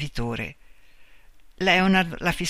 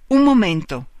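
sudden loud, higher outburst; bursting out; 5 semitones higher, 8 dB louder than usual. A narrator's reading. Marta recovers herself and stops him, bursting out. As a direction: cut in sharply, raised voice. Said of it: "Si riprese allora e sbottò".

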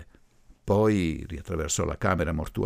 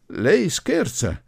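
slow, loud, high-pitched objection; indignant; 10 semitones higher, 6 dB louder, much slower than usual. A narrator's reading. Groom's relative recovering from the torn sleeve, indignant. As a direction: drag it out in disbelief.

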